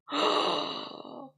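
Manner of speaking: sharp mock-horror gasp; dramatic gasp. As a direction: gasp in fake shock.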